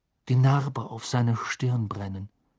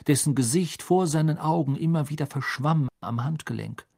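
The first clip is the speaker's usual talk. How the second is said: ordinary delivery, nothing marked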